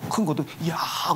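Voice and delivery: soft unvoiced hush; whispering disciple trading gossip. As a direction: hiss it quietly.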